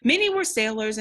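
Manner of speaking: ordinary talk, nothing marked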